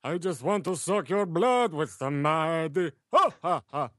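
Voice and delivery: high, sung vampire voice with a mock laugh; Dracula. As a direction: sing it as a hammy vampire. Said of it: "Do it in the Dracula voice".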